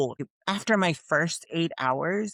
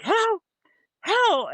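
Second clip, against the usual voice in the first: high, loud mock yell; mom yelling; 4 dB louder than usual.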